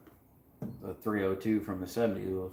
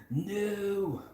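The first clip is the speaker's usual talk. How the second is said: put-on much higher, softer Austrian movie-star accent; 9 semitones higher, 5 dB quieter, slower than usual. Mixing up Australian and Austrian for a laugh, in an Austrian accent. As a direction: quote the action film in a jokey Austrian accent. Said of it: "if it's Australian or Austria".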